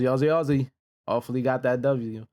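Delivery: ordinary talk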